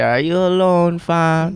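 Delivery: high, loud rap-line delivery; Toronto slang accent. Retold or imitated. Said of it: "when he came on that shit".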